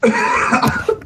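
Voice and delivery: high laugh over the words; squealing laugh. Real, not acted. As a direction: laugh up high mid-word. Player overwhelmed laughing.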